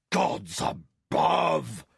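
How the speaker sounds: slow, loud booming giant. A narrator's reading. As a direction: boom it out like a giant. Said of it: "boomed the giant".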